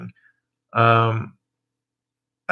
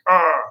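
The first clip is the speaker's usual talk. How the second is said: mock outraged yelp; 6 semitones higher, 5 dB louder than usual. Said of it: "so I can't be like".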